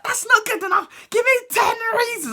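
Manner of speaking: high, demanding voice; hammed-up strict teacher; mock stern teacher.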